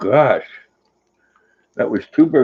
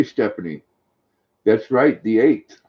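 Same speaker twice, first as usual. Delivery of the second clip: normal talking voice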